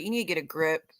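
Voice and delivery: ordinary delivery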